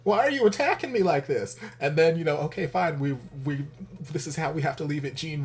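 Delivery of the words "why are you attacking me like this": high, loud mock wail; mock anguish. Viewer mock-wailing at the episode, high and loud. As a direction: cry out as if wounded by the show. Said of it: "I'm like".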